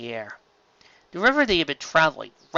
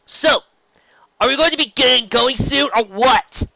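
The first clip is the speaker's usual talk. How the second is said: higher, louder impatient push; louder, higher restless demand; impatient Rainbow Dash; 11 semitones higher, 7 dB louder than usual. Acted. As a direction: hurry them along.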